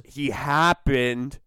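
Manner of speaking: HAPPENED drawn out with sceptical emphasis; slow, pointed stress on HAPPENED; air-quote stress. Real, not acted. Podcaster doubting a viral razor blade video, air-quote stress. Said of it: "that's in air quotes".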